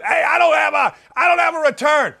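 retold high-pitched complaint; on their case. Host retelling his gripe to producers, on their case. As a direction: reenact your own heated griping at the crew. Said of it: "I'm all over Ryan and Dylan".

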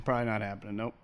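quiet deadpan aside; muttered repeat. Shown as a demonstration. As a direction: mutter it again under your breath. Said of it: "repeated deadpan".

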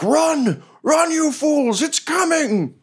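high strained shout; fleeing hobgoblin's warning. Acted. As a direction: scream a desperate warning while fleeing. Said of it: "And he's like".

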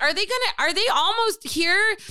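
high, anxious question, acted; mock-anxious plea to a dispatcher; stressed. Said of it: "kind of stressing me out".

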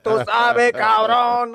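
loud, high Puerto Rican hype; hyped-up girlfriend. Retold or imitated. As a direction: shout it out all hyped up. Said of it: "She hype like".

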